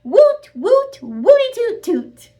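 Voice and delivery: very high loud whoops; whooping cheer. Acted. Students whooping for Rodney, high and loud.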